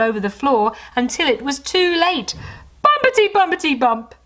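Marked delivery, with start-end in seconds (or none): 2.8-4.2 loud, much higher bumpety; boom. Acted. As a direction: bounce out the words. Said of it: "boomed Santa".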